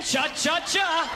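slow hissed ch-ch-ch; slow breathy cha-cha-cha; creepy whisper chant. Retold or imitated. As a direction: breathe it like the Jason sound. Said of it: "There it goes again".

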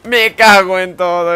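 slow, drawn-out yell of frustration; loud frustrated yell. Real, not acted. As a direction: bellow the curse slowly. Epic kill fails, frustration.

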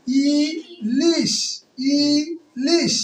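"Ilish" very slowly, "ই", gap, "লিশ"; syllable by syllable. Shown as a demonstration. Teacher counting syllables, "ilish" broken slowly into "ই" and "লিশ". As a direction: stretch and split "ilish" into two syllables. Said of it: "তাহলে ই একটা".

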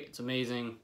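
usual speaking voice

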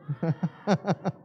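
short amused laugh after the request; a brief real chuckle. Real, not acted. Pastor laughs at the church's feeble shout, amused.

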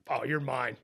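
low, soft claim of victory; quiet gloating. Retold or imitated. Angler to a fish that took his bait, quietly gloating. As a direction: say it privately, dropped right down.

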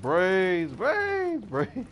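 high, loud zombie moan. Retold or imitated. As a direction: wail it like a cartoon zombie. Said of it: "It was like".

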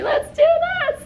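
hoarse voice breaking on a hype line; voice cracking. Real, not acted. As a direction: voice cracks up high. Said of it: "you sound horrible".

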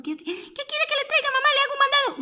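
put-on high eager child voice; eager little turtle offering to help her mother; little tortoise voice. Little turtle answering mama, high and eager.

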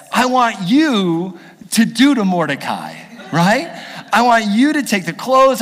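king gleefully commands Haman to honor Mordecai; excited king. Retold or imitated. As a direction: proclaim it with relish and a laugh building. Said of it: "the king was like".

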